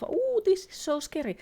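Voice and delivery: high, cooing fake scare; mock fright. Streamer mocking the lost horror element, mock fright. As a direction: put on a high, teasing "scared" voice. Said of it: "the horror element of".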